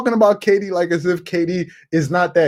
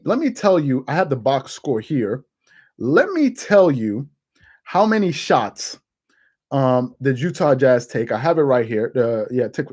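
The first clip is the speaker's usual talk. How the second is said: ordinary talk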